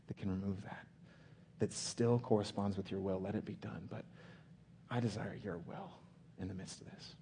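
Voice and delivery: soft, halting prayer; emotionally exposed prayer. Acted. Preacher voices Jesus fallen before his Father, softly exposed. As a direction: pray it quietly, with long pauses. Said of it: "by being emotionally exposed".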